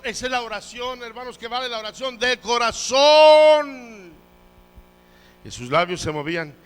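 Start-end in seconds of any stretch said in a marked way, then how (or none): 0.0-4.1 high, loud exhortation; impassioned pulpit shout; preaching shout. Real, not acted. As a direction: build to a long cry on the last word. Preacher climaxes his point.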